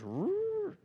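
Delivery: high screeching imitation of a reel's drag; screaming reel drag. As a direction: mimic the line ripping off the reel with a high squeal. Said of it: "his drag just starts screaming".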